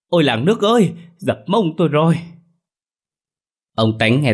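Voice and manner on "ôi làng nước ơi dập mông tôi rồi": high, loud yelp of pain and shock; sudden loud cry. A narrator's reading. Old wife slips in the chicken coop and cries out, loud high yelp. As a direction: cry out to the whole village as she falls. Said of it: "bà Soan bất ngờ hét lớn".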